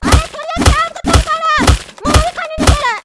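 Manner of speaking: put-on loud, very high child voice; shrill cartoon kid yelling in protest; high, indignant squeal of a child; protesting little boy. Child protests over his momos.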